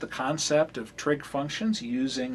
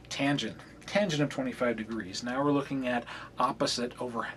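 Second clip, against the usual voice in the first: normal talking voice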